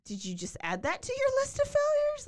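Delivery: high, loud disbelief; incredulous. Real, not acted. Host reacts to the killer's new crime, incredulous and high. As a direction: pitch it up in disbelief.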